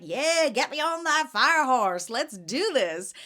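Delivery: high, loud, drawn-out rallying cry; mock gung-ho. Vendor re-enacting her first excitement about the fire horse, gung-ho. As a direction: re-enact an eager charge, slow and punchy.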